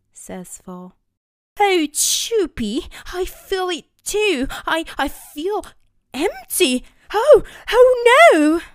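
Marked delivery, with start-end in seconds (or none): 1.6-8.6 high, loud, hollow despair; despairing professor. Acted. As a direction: sound emptied out and lost. Professor feeling empty.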